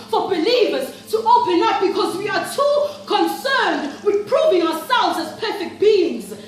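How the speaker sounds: loud, high, driving intensity; impassioned accusation. Acted. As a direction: cry it out with full conviction. Impassioned accusation, then repeated subdued.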